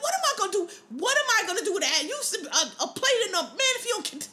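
high, exasperated imitation of an angry dad; mock outrage with laughter breaking in; pissed-off dad. Storyteller imitates dad refusing the stolen address, mock pissed off. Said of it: "he was so pissed".

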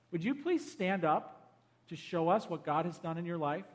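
ordinary delivery

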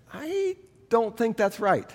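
high, soft, hesitant objection; doubtful. Retold or imitated. Teenage player silently doubting the coach, doubtful. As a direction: draw it out slowly, quietly unconvinced.

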